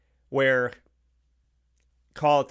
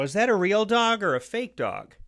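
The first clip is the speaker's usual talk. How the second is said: higher, lightly voiced little girl asking; curious little girl; 8 semitones higher than usual. Little girls in the elevator asking about the dog, lightly voiced child. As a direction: play a curious kid, pitched up.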